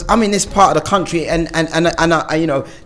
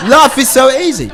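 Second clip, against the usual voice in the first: ironic, laughing, higher and a bit louder; laughing sarcasm; 7 semitones higher, 4 dB louder than usual. Real, not acted. Laughing ironically to the recovery meeting. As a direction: laugh it off with heavy irony.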